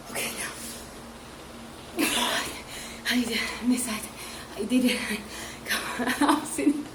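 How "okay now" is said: put-on hushed, drawn-out child's whisper. A child sneaking past her mate, whispered. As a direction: breathe it out slowly like a small kid.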